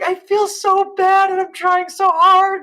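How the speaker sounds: high, loud, anguished retelling; mock near-tears desperation; imitated distraught mother. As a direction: plead helplessly, voice pushed way up.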